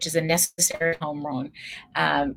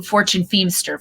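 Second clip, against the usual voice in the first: normal talking voice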